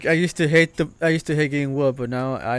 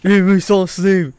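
mock desperate demand; 5 semitones higher, 9 dB louder than usual. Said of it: "waxes the fucking tomahawk".

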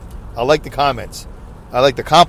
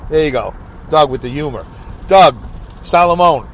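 normal talking voice

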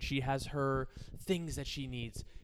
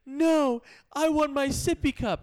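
put-on high, loud kid protest; insistent little daughter; 11 semitones higher, 9 dB louder, slower than usual. Daughter refusing to get her cup, loud insistence. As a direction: imitate a child digging in, loudly. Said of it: "So she's like".

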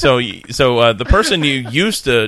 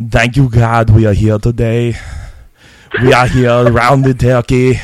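Arnold Schwarzenegger impression; 4 semitones higher, 8 dB louder than usual. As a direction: bellow the grace like Arnold. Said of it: "I can kinda do Arnold Schwarzenegger".

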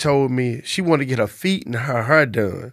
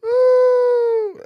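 high falsetto ooh, cry-like; falsetto whoop; 3 dB louder than usual. Real, not acted. Friend whoops at the room-for-rent joke, high falsetto. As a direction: squeal it out in disbelief.

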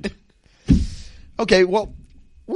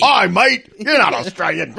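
louder put-on Aussie bird voice; mock Australian accent; 4 semitones higher, 6 dB louder than usual. Australian bird taunting the pigeon in a mock Australian accent. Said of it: "Yeah, you think it Austra".

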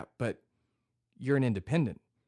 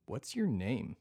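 ordinary delivery

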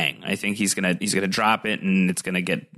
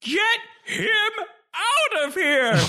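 put-on very high, slow old man's wail; drawn-out, hammed-up urgent cry; Maester Aemon; slower than usual. Warning Gilly as the dying maester, high drawn-out mock wail. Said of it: "He has some advice for Gilly".

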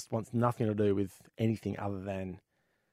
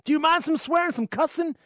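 cowboy voice; 5 dB louder than usual. Acted. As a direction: play the friendly cowboy neighbour big. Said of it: "And the cowboy goes".

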